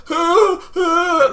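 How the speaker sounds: high, loud acted-out cries; two shrieking wails of panic; panicked wail. Gamer seeing blood squirt, panicked wail.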